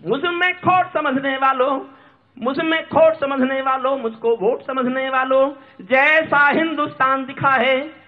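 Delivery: declaimed address to the listeners; impassioned address. Acted. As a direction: recite it high and ringing.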